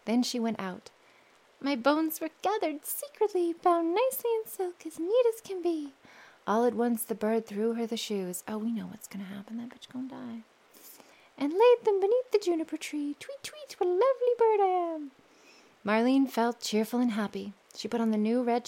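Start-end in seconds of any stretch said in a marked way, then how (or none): 1.6-5.9 higher sing-song bird voice; bird's song. Acted. Bird sings. 8.5-10.4 fast whispered aside. Real, not acted. 11.4-15.1 much higher, louder bird voice; bird's song. Acted. Bird sings.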